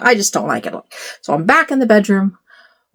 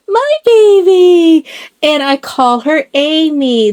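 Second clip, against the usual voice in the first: cooing; 8 semitones higher, 4 dB louder, slower than usual. Real, not acted. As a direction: coo it like a proud mama. Cooing over her doll.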